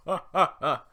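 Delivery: loud, high mock laugh behind them; a big laugh that makes them jump; Shrek's laugh. Shrek laughs behind the villagers, booming mock laugh. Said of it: "sneaks up behind them and laughs".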